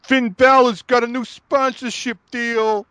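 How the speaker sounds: mock impression of a wrestling promoter; mocking Russo quote. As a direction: ham up a smug jab. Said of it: "tweeted out ... and was like".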